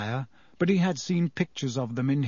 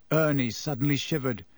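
plain reading voice

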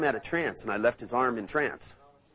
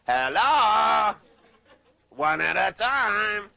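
loud, much higher shout, stretched out slowly; calling out; 12 semitones higher, 6 dB louder, much slower than usual. Retold or imitated. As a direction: snap two confused students back to attention. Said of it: "and I went".

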